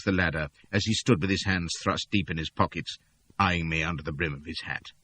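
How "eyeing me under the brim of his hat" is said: usual speaking voice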